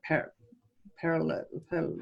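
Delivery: PARALA- broken off in false starts; real struggle to get PARALA- out; tongue-tied stumble. Real, not acted. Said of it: "let's just say it's my accent".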